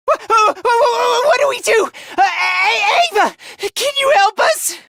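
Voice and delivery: high, frantic stuttering; panicked stammer. Acted. Begging Ava for help, panicked stammering.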